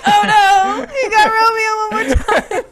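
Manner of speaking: high, loud thrilled cry at the game; excited cry. Real, not acted. Teacher cheering a student's game, thrilled and loud.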